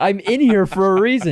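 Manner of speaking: high, loud mock outrage; exasperated comic retort, pitched high; indignant protest. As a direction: protest loudly, acting out the indignation. Acted retort to hospital guy.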